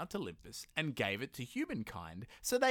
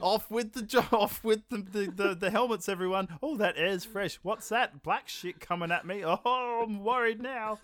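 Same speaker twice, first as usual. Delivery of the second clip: high, lightly hammed-up careless crewman; mock crew member; 8 semitones higher than usual. Mocking the film's crew, high hammed-up careless voice. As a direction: play a clueless astronaut pulling his helmet off. Acting the crew removing helmets.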